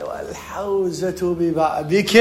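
very slow, drawn-out sheikh's pronouncement; sheikh giving advice. Retold or imitated. Teacher quotes the sheikh handing out advice, slow pronouncement. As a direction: imitate a sheikh, stretching every word solemnly. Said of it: "على قولة الشيخ مرات من يعطي معلومة".

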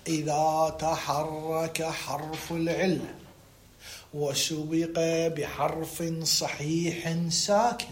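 soft, low, measured recital of a rule; slow dictation. Shown as a demonstration. Teacher giving students the rule, slow dictation. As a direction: dictate it word by word, slowly and softly.

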